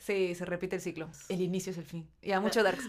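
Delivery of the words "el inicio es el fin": low-key, softly spoken series quote; dark motto. Acted. Friend quoting a dark series' motto, quietly.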